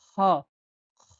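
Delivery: খ brought out from the throat; throaty kh sound. Shown as a demonstration. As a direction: demonstrate খ as a throat sound, twice with a pause. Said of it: "এটা গলা দিয়ে বের করতে হবে".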